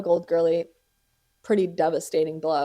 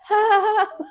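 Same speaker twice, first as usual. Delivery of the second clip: nervous laugh; 6 dB louder than usual. Retold or imitated. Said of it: "she's like".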